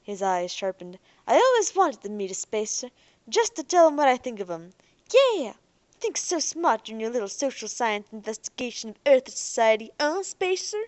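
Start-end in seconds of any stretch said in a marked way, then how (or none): 1.3-5.5 loud, higher, menacing anticipation; hostile relish. Acted. Jake threatens the spacers. 6.0-10.8 contemptuous sneer; spat-out taunt. Acted. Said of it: "it sounded like a spit".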